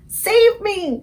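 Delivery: high, loud terrified scream. A narrator's reading. Giant screaming in his nightmare, high loud terror. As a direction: shriek for your life. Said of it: "screamed the flesh lump eater".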